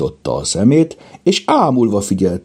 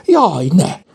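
high alarmed wail; squealing; 12 semitones higher, 4 dB louder than usual. A narrator's reading. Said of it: "siválkodott Kajtánka".

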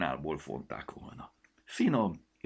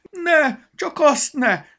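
very high, loud panicked squeal; shrieking; 15 dB louder, much slower than usual. A narrator's reading. The glove begs the goblin not to blow into it, shrieking in panic. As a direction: squeal in terror. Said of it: "visított a kesztyű".

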